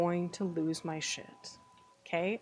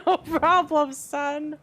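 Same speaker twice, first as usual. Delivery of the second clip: hammed-up dad, loud and high; jokey dad voice answering; mock dad voice; 6 semitones higher, 6 dB louder, slower than usual. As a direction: play the proud game father. Voices game dad replying to son.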